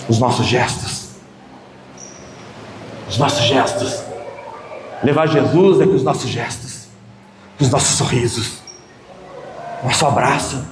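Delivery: hushed sermon meditation; soft reflective preaching. Real, not acted.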